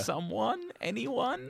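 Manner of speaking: high pleading cry; desperate call for help. Retold or imitated. Echoing the film's cry for help.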